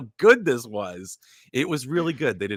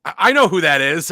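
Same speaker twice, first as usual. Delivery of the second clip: high, loud imitation; coworker's loud recognition; 5 semitones higher, 8 dB louder than usual. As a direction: yell it as the salty old coworker.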